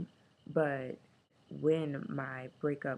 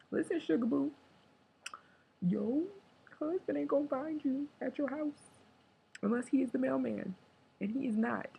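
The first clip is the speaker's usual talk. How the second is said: sassy auntie voice; 9 semitones higher than usual. Pretend. Sassy advice to herself.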